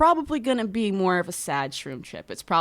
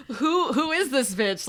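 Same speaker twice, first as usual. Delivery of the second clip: mock disbelief; 7 semitones higher, slower than usual. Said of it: "I'm like".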